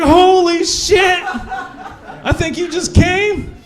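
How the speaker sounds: high, slow, drawn-out disbelief; astonished. Retold or imitated. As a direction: gasp it out amazed. Shock she finished.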